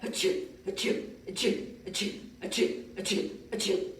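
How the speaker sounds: seven quick airy sneezes; imitated sneezes. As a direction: sneeze it out like a little boy. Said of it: "the boy sneezed seven times".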